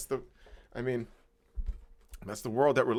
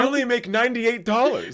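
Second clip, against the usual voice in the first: higher, louder, pitiful voice of a broke guy on the news; mock sad interviewee; 13 semitones higher, 8 dB louder than usual. Friend mocks the comic's sad news interview, pitiful whine. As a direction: whine it out like a sob story on TV.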